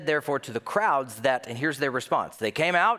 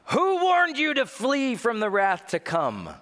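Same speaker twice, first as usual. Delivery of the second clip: high, louder prophet's thunder; mock fiery rebuke; 10 semitones higher, 4 dB louder than usual. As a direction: thunder at the crowd. John voiced rebuking crowd.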